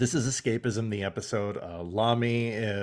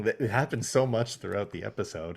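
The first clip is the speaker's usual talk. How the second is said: normal talking voice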